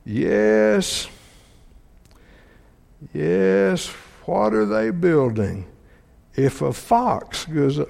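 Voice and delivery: slow, scornful sneer at the builders; sarcastic mockery. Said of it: "can you tell me the tone of voice here?".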